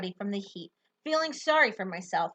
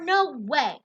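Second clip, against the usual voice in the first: usual speaking voice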